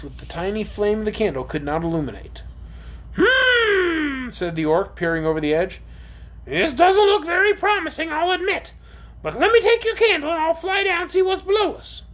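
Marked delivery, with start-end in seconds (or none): 3.2-4.3 high, drawn-out creature hum; pondering orc. Acted. 6.4-12.0 high-pitched creature voice; doubtful but willing orc. Acted.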